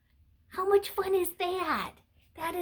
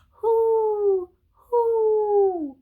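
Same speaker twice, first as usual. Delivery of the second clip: owl hoot; 6 semitones higher, 8 dB louder than usual. Acted. Owl appears in book.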